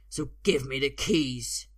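insistent, emphatic villain; forceful demand. A narrator's reading. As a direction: press each word as a threat. Fighter demands keys from boy.

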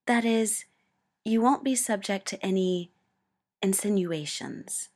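loud but measured, choosing each word; careful. A narrator's reading. Mustang carefully explaining the promotion to Ed. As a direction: spell out an awkward fact with care. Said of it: "he just sounded careful".

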